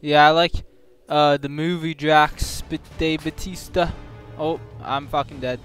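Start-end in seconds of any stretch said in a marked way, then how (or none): none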